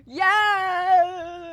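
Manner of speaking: high, loud joyful yell; excited cry. Acted. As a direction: burst out with joy. Cheering at rescuers' arrival.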